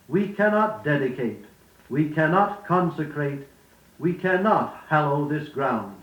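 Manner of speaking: "cannot" hit firmly in each of the three phrases; stress on cannot. Shown as a demonstration. Demonstrating stress for students, "cannot" emphasized each time. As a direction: lean on "cannot" each time, building the parallel. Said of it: "notice what words are naturally stressed".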